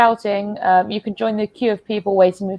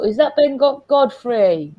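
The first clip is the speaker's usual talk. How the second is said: usual speaking voice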